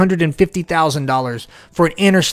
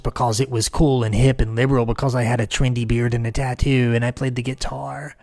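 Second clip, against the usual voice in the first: mock seductive macho; 6 semitones lower than usual. Boasting to a woman, low mock seductive voice.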